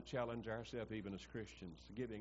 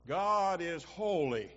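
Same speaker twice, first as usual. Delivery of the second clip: slow, louder and higher each time; building proclamation; 8 semitones higher, 9 dB louder, much slower than usual. Shown as a demonstration. Preacher showing the angels' threefold cry, slow and loud. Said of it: "they would speak three times".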